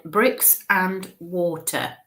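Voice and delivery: slow, word-by-word relay; deliberate, spaced-out words; broken down slowly. Retold or imitated. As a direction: relay it piece by piece so it's understood. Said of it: "she's breaking it down for me to understand".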